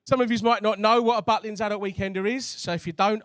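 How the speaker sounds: ordinary talk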